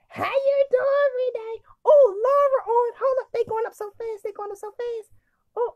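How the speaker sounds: very high, loud squealing hellos; gushing high-pitched excitement; excited greeting. Real, not acted. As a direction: squeal each greeting as friends pop up. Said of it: "I'm all excited".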